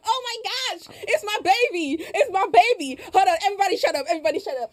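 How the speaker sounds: high, hammed-up girlfriend excitement; Ashley. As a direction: gush over his call, pitched high. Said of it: "I'll be Ashley".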